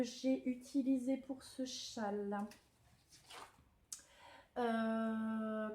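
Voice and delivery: ordinary talk, nothing marked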